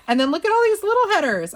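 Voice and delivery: high, loud, quick burst of delight; squealed, rushed excitement; excited discovery. Real, not acted. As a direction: gush over a fun find. Delighted at finding little headers.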